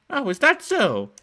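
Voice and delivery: put-on old woman luring kids with cookies, high and sweet; sugary old woman. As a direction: coo like a too-sweet grandma.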